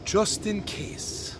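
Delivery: put-on slow, hushed old Irish brogue. Great-nephew imitating his great aunt's reply, slow Irish brogue. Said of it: "in her thick Irish brogue".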